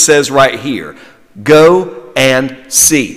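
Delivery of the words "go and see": slow emphatic phrase; slow, weighty. Shown as a demonstration. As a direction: go and see, drawn out slowly. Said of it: "Three words, and they'll preach".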